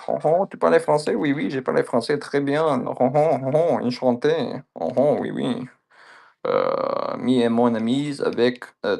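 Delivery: playful gibberish French, lightly voiced; goofing in Quebecois French; Quebec French accent. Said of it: "I can just speak gibberish in French".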